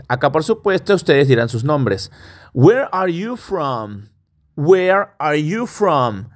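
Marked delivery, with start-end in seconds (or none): 2.5-6.3 slow model line. Shown as a demonstration. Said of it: "slow model for repetition".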